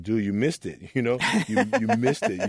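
gleeful, the last missed it jumping high; retold boys gloating with a laugh; laughing teasing boys. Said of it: "And they're like".

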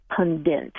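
"pundit" said PUN-dint; "pundit" with -dint at the end; non-standard pronunciation. Shown as a demonstration. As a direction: add an n before the final t of "pundit". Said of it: "that's non-standard".

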